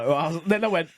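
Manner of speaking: normal talking voice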